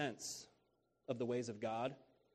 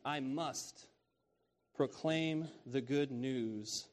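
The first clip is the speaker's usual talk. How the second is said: slow and deliberate; slower than usual. Shown as a demonstration. Preacher repeating Jesus' words slowly for his listeners. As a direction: proclaim said slowly, word by word. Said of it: "That's something that Jesus must do".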